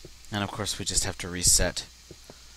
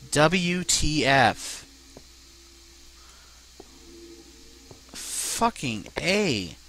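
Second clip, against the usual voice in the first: drawn-out exasperation; 6 semitones higher, much slower than usual. Real, not acted. Frustrated at lost carts.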